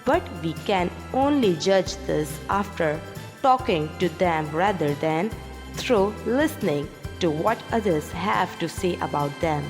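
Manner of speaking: usual speaking voice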